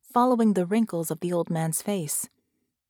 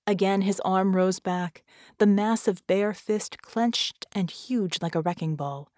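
ordinary delivery, nothing marked

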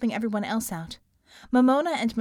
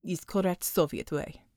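put-on Russian accent; 4 semitones lower, 4 dB quieter than usual. A narrator's reading. Russian character voice.

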